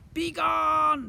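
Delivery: high, loud banishing cry; screaming. A narrator's reading. Both twins banishing slime, high loud scream. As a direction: scream the command. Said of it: "they both screamed".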